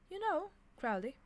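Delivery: high, fond angel voice; a pleased angel, lightly voiced; beaming. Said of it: "he said, beaming".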